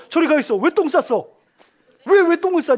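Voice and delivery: loud, high mock scolding of a toddler; pretend scolding. Pretend dad yelling at his toddler, loud mock scolding. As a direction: bark it like an impatient dad. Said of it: "제가 이랬을까요?".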